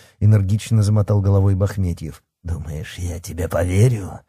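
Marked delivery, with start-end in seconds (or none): 2.4-4.2 low-key sceptical growl. A narrator's reading. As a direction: growl it with restrained menace. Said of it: "рыкнул оборотень".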